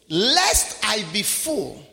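Lest drawn out slowly; slow reading. Real, not acted. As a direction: read Lest I be full slowly, weighing each word. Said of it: "notice verse nine".

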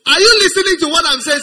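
a preacher shouting to rouse listeners; demanding shout. Real, not acted. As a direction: call out the congregation.

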